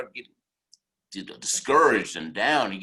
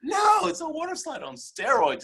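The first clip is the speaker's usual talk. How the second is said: high-pitched dramatic exclamation; emphatic outburst. Retold or imitated. Motivational speaker dramatizing a scary ride, emphatic high shout.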